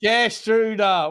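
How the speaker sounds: Gerstruda said slowly as ker-stroo-dah; confirming the pronunciation. Shown as a demonstration. Host confirms the co-host's Gerstruda, slowly as ker-stroo-dah. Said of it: "Well done".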